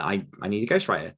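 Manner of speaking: ordinary delivery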